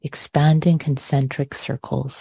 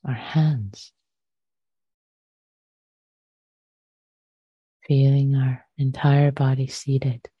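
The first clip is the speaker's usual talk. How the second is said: measured meditation guidance with long pauses; short phrases, unhurried, letting silence settle between them; guided meditation. Acted. Teacher guiding listeners to arrive in body and breath, guided meditation.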